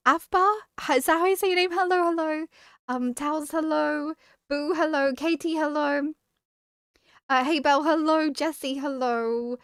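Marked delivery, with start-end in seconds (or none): none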